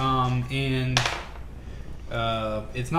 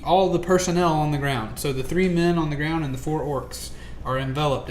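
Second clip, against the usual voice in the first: ordinary delivery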